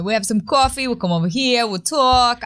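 light Long Island accent; we'll talk, said in a Long Island accent. Shown as a demonstration. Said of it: "But do your Long Island accent".

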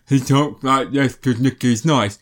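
slow, dopey nice-guy voice; stupid Little Nicky voice. Retold or imitated. Imitating Adam Sandler's silly nice-guy voice. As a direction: put on a silly simple voice. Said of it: "he does a stupid voice".

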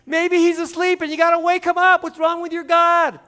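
loud, high jeering taunt. Acted. Elijah taunting the prophets whose god won't answer, loud jeering. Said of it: "Elijah taunted them".

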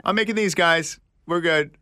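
high, playful call-out; pretend announcement. Telling the family about their own tube, pretend calling out.

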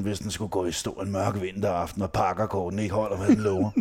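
ordinary talk, nothing marked

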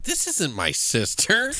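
mock the sister's flat denial; slow, puzzled this isn't my sister; indignant sister. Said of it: "she goes in there and she's like".

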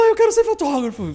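high, loud laughing cry of decision; excited outburst. Retold or imitated. Finally telling his friend what he wants, a high, loud, laughing outburst. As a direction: blurt it out, half laughing.

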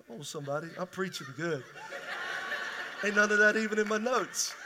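a quiet laughing aside to the congregation. Real, not acted. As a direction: chuckle it out under your breath.

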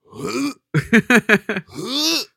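a high, loud laughing fit mimicked; imitated drunk laughing. Roommate hears him laughing alone, imitated high and loud. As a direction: mimic his own giggling heard through the wall. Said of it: "and I just hear you, like".

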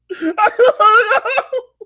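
high crying laugh; high laughing wail. Real, not acted. Laughs after mock wail.

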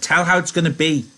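high, loud, excited request; eager Lennie. A narrator's reading. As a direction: beg for a favourite story. Reader voices Lenny begging for the dream.

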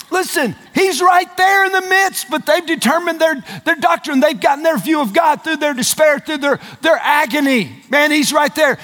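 high, impassioned preacher shout; high-pitched pulpit delivery; full-force preaching. Real, not acted. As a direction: preach it with mounting incredulity.

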